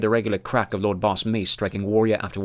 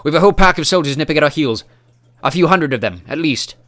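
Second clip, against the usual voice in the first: urgent loud; 6 semitones higher, 10 dB louder than usual. A narrator's reading. Dwarf warning, breathless.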